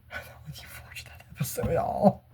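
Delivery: breathy whispered dread, almost no voice. Real, not acted. Whispering to viewers about a dreaded episode, hushed. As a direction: whisper it like you can't bear to say it.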